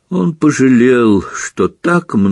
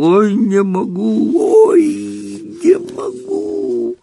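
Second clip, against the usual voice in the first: rising squeal; much slower than usual. Acted. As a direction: pitch climbing to a squeak.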